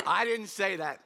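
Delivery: laughing it off. Real, not acted. Preacher laughing at his own slip. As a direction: laugh and take it back.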